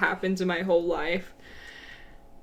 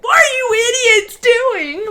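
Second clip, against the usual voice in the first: high, loud angry shout; 13 semitones higher, 9 dB louder than usual. Retold or imitated. Sister yells at siblings burning ramen, furious shout. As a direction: yell at the siblings in fury. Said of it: "Like, so angry".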